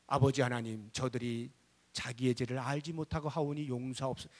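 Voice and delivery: low, softened praying voice; gentle forgiving prayer. Acted. Praying like Jesus for enemies' forgiveness, low and gentle. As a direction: imitate a meek plea for mercy.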